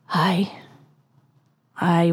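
whispered, almost breathless; hushed aye. Acted. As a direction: breathe the word out softly. Ahab tender with Starbuck.

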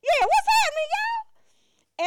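high, loud, drawn-out hello; exaggerated greeting. Walking in on the family, cheery loud greeting. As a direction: sing out the greeting to the room. Greeting repeated, louder and higher.

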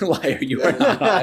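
laughing read of the comment. Retold or imitated. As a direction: giggle the quote out.

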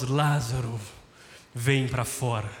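deep commanding voice summoning the dead; booming heavenly voice. Pretend. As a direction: call out like a voice from heaven. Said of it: "Aí do nada vem uma voz".

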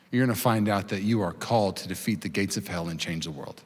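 soft, low, intimate sermon voice; tender preaching. Acted. Pastor telling listeners they are world changers, soft and low. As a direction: speak it gently into hearts.

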